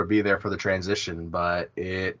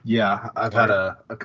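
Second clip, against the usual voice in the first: ordinary delivery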